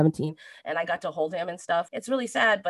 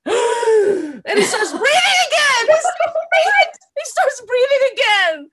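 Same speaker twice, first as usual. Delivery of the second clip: much higher and louder, half laughing, half crying; gasping disbelief; 10 dB louder than usual. Retold or imitated. Telling how her dying dad started breathing again, shocked and laughing.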